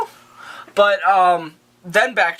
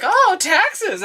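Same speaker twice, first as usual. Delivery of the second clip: very high silly small-talk voice; hammed-up high-pitched chitchat; mock Bruce Wayne; 13 semitones higher than usual.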